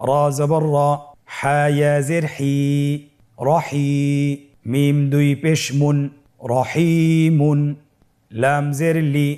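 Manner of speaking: slow, chant-like spelling with long vowels; spelling aloud. Shown as a demonstration. Teacher has learners spell along, slow sustained recitation. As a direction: spell each syllable, then draw the joined word out. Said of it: "আমার সাথে বানান করে বলুন".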